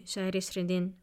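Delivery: ordinary delivery, nothing marked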